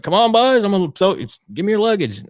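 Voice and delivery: put-on a young pilot hollering to the boys, high and bright; young pilot, high and loud. Young pilot calls the boys to the plane, high and loud. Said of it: "He's like".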